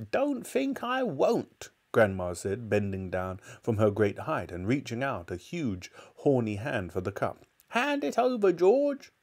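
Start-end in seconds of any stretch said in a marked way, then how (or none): 0.1-1.7 put-on high, slow old-woman defiance; defiant grandma. A narrator's reading. Grandma defiantly takes the cup. 7.7-9.1 put-on high-pitched old-woman command; demanding grandma. A narrator's reading.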